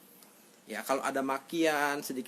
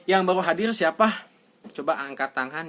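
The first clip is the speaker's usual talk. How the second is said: calling out; 5 semitones higher, 11 dB louder than usual. Real, not acted. Calling out to the audience.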